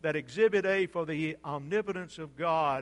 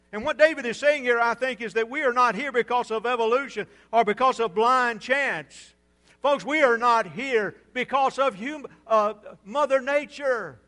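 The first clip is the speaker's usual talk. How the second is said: loud, high, insistent pulpit voice; raised-voice sermon delivery; emphatic preaching; 4 semitones higher, 7 dB louder than usual. Real, not acted. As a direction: press the point hard on the congregation. Preaches with force.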